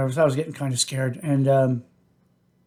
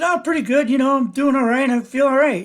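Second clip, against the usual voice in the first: higher, upbeat and breezy, no worries at all; carefree cheer; 9 semitones higher than usual. Retold or imitated. Patient cheerfully telling the oncologist he's fine, breezy and carefree. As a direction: sound like nothing's wrong.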